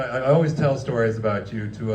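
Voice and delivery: ordinary delivery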